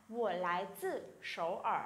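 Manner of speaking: slow, careful Mandarin question; slow, syllable by syllable Chinese demo; slow model reading. Shown as a demonstration. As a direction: read it out slowly for students to copy. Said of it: "tôi sẽ nói là".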